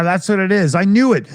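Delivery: usual speaking voice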